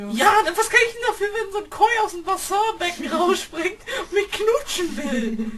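high, loud, fast laughing outburst; laughing protest. Real, not acted. Sister laughing while defending herself against teasing. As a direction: protest through bursts of laughter.

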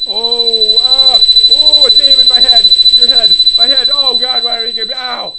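loud groaning cries of a splitting headache; pained yelling. Acted. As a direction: yell out as if your head is splitting. Head pain in the game.

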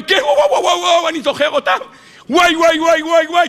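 high laughing wailing wows; hammed-up panic at the memory; mock panicked wail. Pharaoh remembering the Red Sea, mock panicked wailing.

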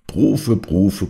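calling the magic word out loud, solemnly; loud ceremonial incantation. Acted. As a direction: intone it like a spell, loudly. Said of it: "Laut und feierlich klang Cherubims Stimme".

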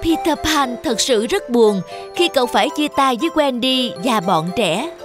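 ordinary delivery

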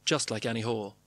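usual speaking voice

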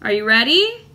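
ordinary delivery

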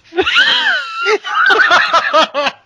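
loud, high cackling laugh; big shrieking laugh that keeps going; real burst of laughter. Real, not acted. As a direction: crack up helplessly over a flubbed line. Cracks up after botched sign-off.

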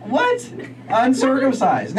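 loud, high mock outrage at the answer; shocked disbelief. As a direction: voice jumps way up in disbelief. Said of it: "I'm like".

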